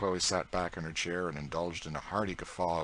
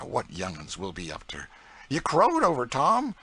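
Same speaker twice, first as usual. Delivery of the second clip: put-on higher, louder proud old cook in broad dialect; Aunt Chloe's voice; 6 semitones higher, 7 dB louder than usual. A narrator's reading. Aunt Chloe boasting to young George, broad proud dialect. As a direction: boast with a scornful toss of the head.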